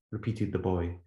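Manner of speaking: ordinary talk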